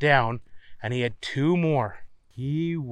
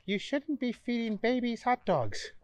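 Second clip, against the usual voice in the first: high, polite hostess voice; sweet and helpful, pitched up; Donna's voice; 8 semitones higher, 4 dB quieter than usual. Acted. Donna corrects him.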